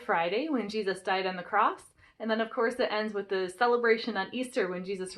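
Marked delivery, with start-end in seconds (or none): none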